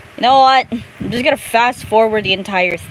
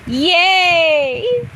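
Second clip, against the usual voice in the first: high happy yay; excited squeal; 8 semitones higher than usual. Real, not acted. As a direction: squeal with delight. Gets money from trainer.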